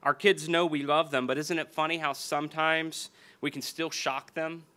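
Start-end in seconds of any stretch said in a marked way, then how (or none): none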